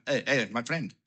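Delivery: lightly attempted Italian accent; a hint of an Italian man's accent. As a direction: have a small go at an Italian accent. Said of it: "I was gonna do the accent".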